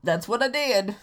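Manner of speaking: cocky, loud yep; smug year voice. Retold or imitated. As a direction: brag like a smug rival. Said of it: "And twenty nineteen was like".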